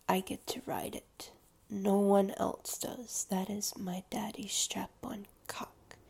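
hushed, whispery claim of ownership; imagined possessive sub. Pretend. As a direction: claim it softly, leaving space between phrases. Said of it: "like saying".

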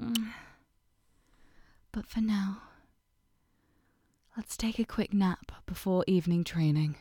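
low, soft intimate whisper; tender whisper. Acted. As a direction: whisper lovingly close to ear. Whispered aftercare.

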